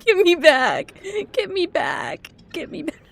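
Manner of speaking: high, panicked plea; frightened plea. Real, not acted. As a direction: beg to be taken back. Scared player in dark water.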